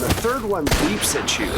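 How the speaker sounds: normal talking voice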